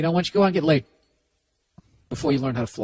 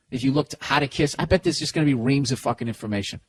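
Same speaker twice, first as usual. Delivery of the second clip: usual speaking voice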